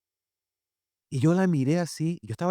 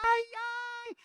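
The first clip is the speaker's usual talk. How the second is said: high, drawn-out "ay" wail; mock lament, sliding high; 6 dB quieter than usual. Preacher recalls dreading mother's punishment, mock wail.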